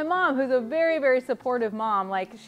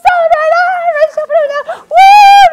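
shrieked high da-da chant into a whoop; party like the seminar crowd; frenzied, loud mock cheering; wild celebration; 21 dB louder than usual. Mimics seminar celebration music.

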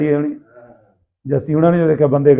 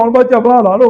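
higher, louder urgent call; hearty loud trucker banter; 6 semitones higher, 5 dB louder than usual. Retold or imitated. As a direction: bark the order to run. Urging friends to run off.

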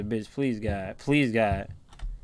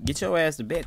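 ordinary talk

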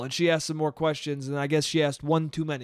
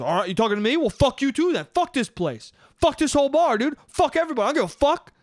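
high, raised-voice mock outburst; imitated indignant ranting, pitched way up; retold angry tirade; 7 semitones higher than usual. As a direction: act out cursing everyone on the way out. Retold man's furious exit tirade.

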